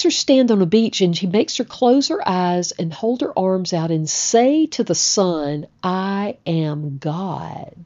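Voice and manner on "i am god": very slow, soft declaration; solemn affirmation. Retold or imitated. Actress declares to the sun, softly and solemnly. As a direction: draw each word out softly, with reverence.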